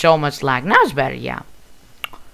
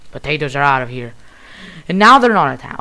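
ordinary delivery

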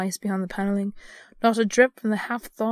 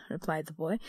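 ordinary delivery, nothing marked

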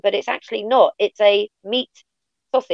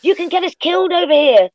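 mock alarmed warning; 7 semitones higher than usual. Imitating Americans warning about the word, mock alarm. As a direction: play a scandalized friend, pitched well up.

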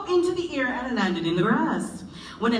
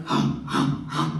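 hog grunts; slower than usual. Acted.

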